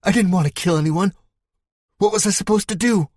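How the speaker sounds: high, fast and desperate; pleading that he had no choice; panicked. Acted. Man blurting out his guilt, panicked and fast.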